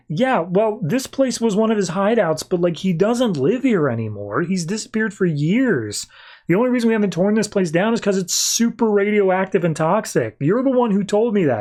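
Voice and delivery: lightly voiced annoyed reporter; a hint of a fed-up Lois. Lois voiced in retold comic.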